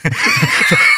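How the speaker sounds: sudden burst of high laughter; loud laugh. Real, not acted. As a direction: crack up at the joke.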